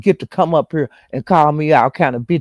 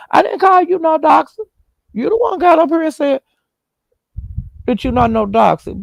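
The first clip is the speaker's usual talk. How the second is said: indignant retort; 12 semitones higher than usual. Retold or imitated. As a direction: fire it back at her, pitched way up. Arguing back at Beans and Cornbread.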